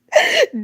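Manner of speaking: sudden high laugh burst; a real yelp of a laugh; high squeal of laughter. Real, not acted. Streamer cracks up at Chipper's comment, high squeal of laughter.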